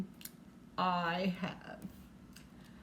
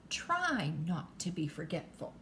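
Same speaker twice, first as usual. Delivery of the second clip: usual speaking voice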